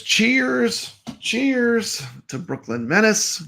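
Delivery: cheerful toast. Real, not acted. Toasting a chat viewer.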